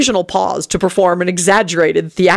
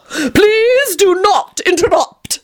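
much higher, very slow, drawn-out Please do not interrupt; haughty vampire calling out to silence everyone; calling out loudly; 14 semitones higher, much slower than usual. A narrator's reading. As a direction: call it out theatrically over the room. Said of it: "he called out loudly".

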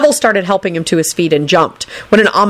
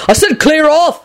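raised, high-pitched shout; shouted order; 10 semitones higher than usual. A narrator's reading. Neville at the lingering girls, shouting. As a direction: shout them away, fed up. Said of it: "Neville shouted at the girls".

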